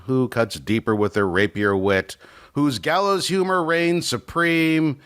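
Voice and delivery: ordinary talk, nothing marked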